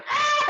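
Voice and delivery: high panicked scream. Retold or imitated. Kayaker screaming as the whale surfaces, panicked shriek. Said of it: "I was literally just screaming".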